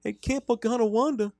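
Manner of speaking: put-on very high-pitched Wisconsin accent, cheery and creepy; sing-song high camp instructor in a Wisconsin lilt; creepy camp counselor voice. Host playing a creepy camp instructor to kids. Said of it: "Sell it".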